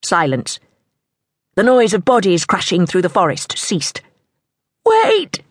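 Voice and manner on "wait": high, pleading call; desperate cry, pitched way up. A narrator's reading. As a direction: call out to halt pursuers. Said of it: "she called again".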